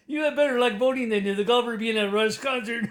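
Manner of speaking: can barely get the words out for laughing. Real, not acted. Mocking Conservative voters, laughing.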